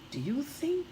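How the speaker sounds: ordinary delivery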